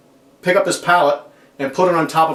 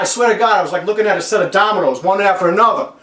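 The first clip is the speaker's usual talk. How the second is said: normal talking voice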